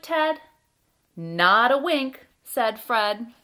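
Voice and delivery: loud, deliberate picture-book narration with pauses; reading to children. A narrator's reading. Storyteller reads a bedtime tale about Fred and Ted, lively read-aloud. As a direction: read it out with big, bouncy emphasis.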